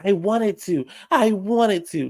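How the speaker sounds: high-pitched whiny mimicry; mocking Dorit. Dorit answering Garcelle, mocked in a high voice.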